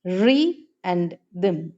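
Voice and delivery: re and them, said slow with the syllables pulled apart; teacher splitting re and them for the class; slowly split into syllables. Shown as a demonstration. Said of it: "Rhythm can be syllabified".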